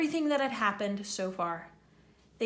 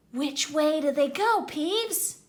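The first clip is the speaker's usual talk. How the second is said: higher, louder urgent caretaker; Filch, demanding; 6 semitones higher, 6 dB louder than usual. Acted. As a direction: demand answers from Peeves.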